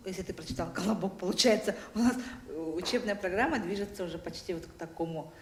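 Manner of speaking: normal talking voice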